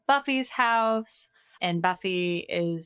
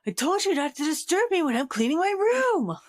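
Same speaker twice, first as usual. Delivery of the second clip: high, louder put-on Doofy voice; 10 semitones higher, 4 dB louder than usual. Goofy brother scolding his mom, high and loud. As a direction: play the goofy brother, pitched up.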